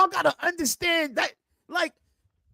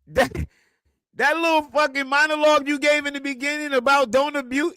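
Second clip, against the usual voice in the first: ordinary delivery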